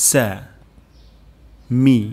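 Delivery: সা, মি split into separate syllables. Shown as a demonstration. Teacher spelling out সা মি syllable by syllable. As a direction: break সা, মি apart with pauses. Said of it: "প্রথম শব্দটি হলো".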